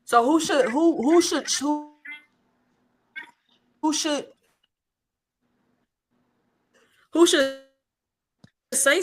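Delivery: choppy, breaking up call audio; bad connection. Real, not acted. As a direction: voice cutting in and out. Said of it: "you sound a little choppy".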